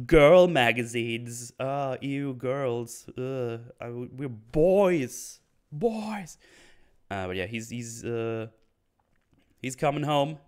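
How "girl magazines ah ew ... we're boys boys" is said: exaggerated boyish "ew", pitched up; mock disgust. Host joking about girl magazines, mock disgust. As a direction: play at being grossed out, drawing words out loudly.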